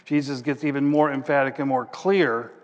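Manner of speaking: ordinary talk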